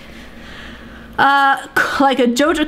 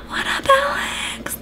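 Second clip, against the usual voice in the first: whisper; 13 semitones higher, 6 dB quieter than usual. Pretend. Sneaking up on Alex.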